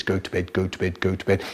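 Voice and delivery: low, soft monotone chant; droning nagging brother. Retold or imitated. Older brother endlessly ordering sibling to bed, low nagging drone. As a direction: drone it like a stuck record.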